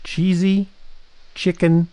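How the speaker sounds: each word drawn out slowly and separately. Acted. Reading the dish name slowly for the co-host. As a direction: spell the dish name out with pauses. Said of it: "you gotta say it slow".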